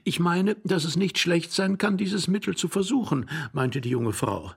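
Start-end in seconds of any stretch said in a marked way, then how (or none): 0.0-3.3 put-on higher-pitched young woman's voice; young wife. A narrator's reading. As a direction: make a higher, polite suggestion as the wife. Young wife speaks in story.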